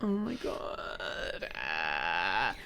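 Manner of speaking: slow whispered awe; breathless whisper. Real, not acted. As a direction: whisper it, overcome by cuteness. Overwhelmed by cuteness.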